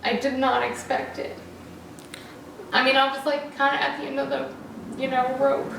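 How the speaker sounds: choked up, pushing through the words; getting emotional. Real, not acted. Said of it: "sorry, I'm getting emotional".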